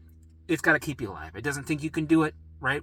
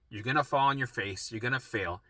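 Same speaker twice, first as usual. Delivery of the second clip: normal talking voice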